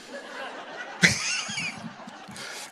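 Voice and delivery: very high, soft airy squeal; Pauline's laugh. Retold or imitated. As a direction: imitate a woman's long squealing laugh. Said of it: "I've seen Pauline laugh".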